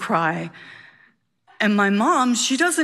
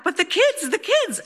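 frantic cry, pitched way up; screaming back; 10 semitones higher than usual. Retold or imitated. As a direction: shriek it back, panicked. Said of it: "And she screams back".

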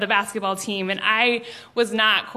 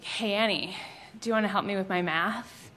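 much softer, quiet sly ask; hushed classroom ask; 2 semitones lower, 9 dB quieter than usual. Retold or imitated. Boy poking classmate across the aisle, hushed sly ask. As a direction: lean in and ask under your breath. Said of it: "he's like".

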